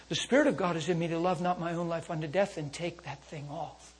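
normal talking voice

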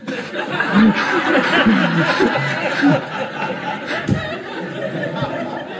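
long breathy laugh; helpless drawn-out laughter. Real, not acted. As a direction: crack up at your own story. Laughing at his own story.

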